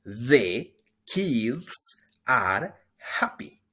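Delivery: slow, deliberate model line again; drawn out so learners can copy; slow teacher repetition; slow repeat. Shown as a demonstration. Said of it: "Una vez más".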